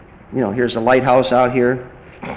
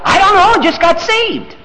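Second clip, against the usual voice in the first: high, loud exasperated reply; high-pitched, hammed-up insistence; mock clueless excitement; 5 dB louder than usual. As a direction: blurt it as the clueless convert. Acted clueless rescued person, heightened.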